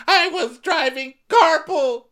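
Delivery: high, slow, loud imitated outburst; mock shrill shout. Host mimicking Whitney's shouting clip, loud and high. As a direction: yell it like a caricatured meltdown. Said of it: "Bring your voice down, little girl".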